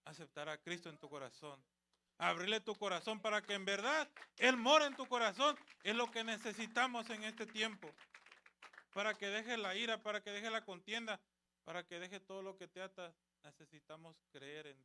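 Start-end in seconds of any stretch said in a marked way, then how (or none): 2.2-11.2 prédica a plena voz. Real, not acted. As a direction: drive it home to the congregation, much louder. Preacher exhorts at full force. (predicando con fuerza, a viva voz)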